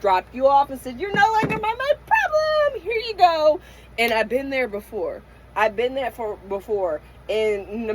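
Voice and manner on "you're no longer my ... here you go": dismissive. Retold or imitated. Brushing someone off in quote.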